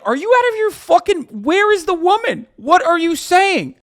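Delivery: incredulous. Pretend. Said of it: "Everyone would look at you like".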